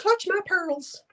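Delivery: very high, drawn-out squeaky pretend voice; clutch-your-pearls falsetto; mock pearl-clutching. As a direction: play a scandalized lady, way up high and slow.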